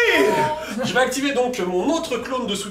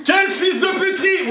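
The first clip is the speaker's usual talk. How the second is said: mock outraged shout; 9 semitones higher than usual. Real, not acted. Joueur peste contre le coup.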